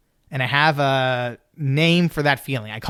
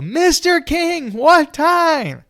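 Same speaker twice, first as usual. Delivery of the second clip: slow, much higher and louder pleading cry; begging shout; 5 dB louder, much slower than usual. Retold or imitated. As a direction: beg at the windshield, high and loud.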